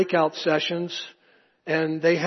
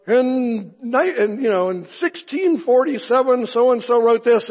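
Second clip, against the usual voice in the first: mock R C Sproul; 7 semitones higher, 6 dB louder than usual. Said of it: "And R C would go".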